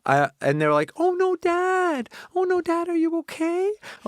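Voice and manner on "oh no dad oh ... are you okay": put-on worried kids. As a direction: imitate alarmed children fretting over Dad. Voicing his worried kids.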